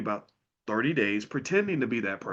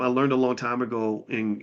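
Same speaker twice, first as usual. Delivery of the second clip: ordinary delivery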